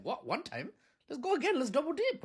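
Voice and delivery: high, eager mock voice; gleeful US. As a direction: act keen to do it again. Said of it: "they're like".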